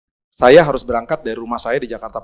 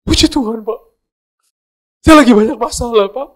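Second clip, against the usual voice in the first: higher, louder tearful pleading; imitating crying driver; 11 semitones higher, 9 dB louder than usual. Taxi driver crying to the preacher, high and loud pleading. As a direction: wail it out like a man breaking down. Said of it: "tiba-tiba dia nangis".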